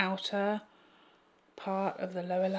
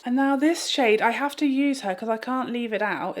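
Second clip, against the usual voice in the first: bright, louder push on the new shade; louder, excited announcement; 4 semitones higher, 6 dB louder than usual. Real, not acted. Vlogger announcing the last shade, louder. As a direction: announce the next shade with a burst.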